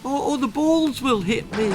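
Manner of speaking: put-on high, stammering frightened boy; quaking. A narrator's reading. Terrified boy pleading with the bully, high and stammering. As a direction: tremble through the excuses. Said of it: "quaked Timothy".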